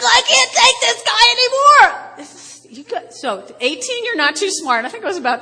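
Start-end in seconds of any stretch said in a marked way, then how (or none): 0.0-2.2 high, loud fed-up outburst; mock furious wife in the car; exasperated yell. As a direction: shout it as you fling the door. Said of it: "I was like".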